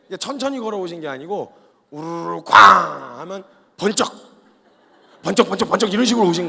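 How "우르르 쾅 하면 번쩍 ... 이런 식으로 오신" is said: loud crash. Pretend. Lightning crash sound effect.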